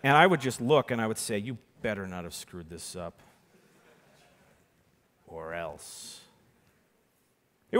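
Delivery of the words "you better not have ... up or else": low, soft menacing growl; menacing threat. Retold or imitated. Teenage magician threatening the client, low and menacing. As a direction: threaten him quietly, all menace. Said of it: "all the menace that nineteen-year-old me could muster".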